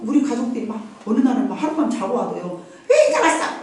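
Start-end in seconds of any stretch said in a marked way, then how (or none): 2.9-3.6 high, complaining question; mock a nagging welcome; high-pitched mock whine; whiny family member. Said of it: "막 이러지 않아요".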